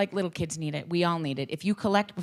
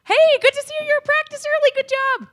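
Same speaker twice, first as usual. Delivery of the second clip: much higher, bright overly cheerful greeting; cheerful coach greeting; 10 dB louder than usual. Retold or imitated. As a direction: greet the kid with exaggerated cheer. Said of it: "I'm like".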